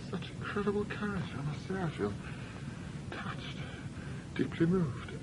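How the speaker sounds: very soft, halting wonder; moved, musing. Acted. Man touched by a young woman's message, hushed. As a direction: murmur it in broken phrases, deeply touched.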